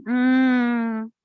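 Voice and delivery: ordinary delivery, nothing marked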